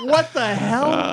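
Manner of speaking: high, slow, drawn-out disbelief; slow mock bewildered. Storyteller mimicking his own slow baffled reaction, high. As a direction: stretch it out, baffled. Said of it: "I'm like".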